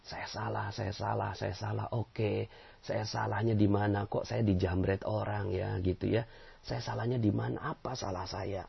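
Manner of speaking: soft introspective murmur; quiet self-questioning. Shown as a demonstration. Preacher models quiet introspection after being robbed. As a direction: ask yourself softly where you went wrong. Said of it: "Dalam diri kita".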